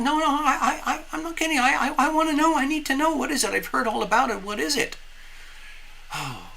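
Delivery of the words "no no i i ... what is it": much higher, earnest little fish voice; insistent little fish. Acted. Little fish begging the snorkeler to explain, high and insistent. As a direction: plead in a high, stammering little voice. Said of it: "the little fish says".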